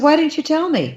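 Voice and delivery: high-pitched, incredulous question; amazed protest. Retold or imitated. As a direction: demand it high, in disbelief. Amazed, confronting Stan over the news.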